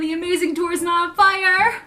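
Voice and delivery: excited shout. Real, not acted. Fan announcing concert tickets, excited shout. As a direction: belt out the tour name with glee.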